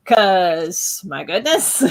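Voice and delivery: exasperated words breaking into a laugh; rueful laughing. Real, not acted. Artist looking back on drawing palace scenes, laughing ruefully. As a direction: laugh ruefully at your own past struggle.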